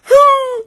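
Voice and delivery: high loud puppy yelp; puppy whimper. Retold or imitated. Imitating a puppy's cry, high loud yelp. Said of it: "hear a puppy go".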